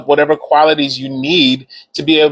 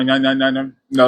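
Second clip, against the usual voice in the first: usual speaking voice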